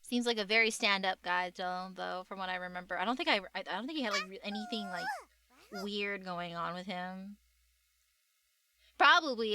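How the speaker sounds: normal talking voice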